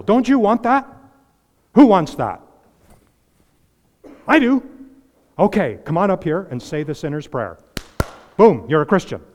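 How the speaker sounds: high, loud hammed-up revival pitch; mock altar call. Preacher parodying a cheap altar call, hammed-up. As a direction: ham up the easy altar call. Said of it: "And it sounds like this".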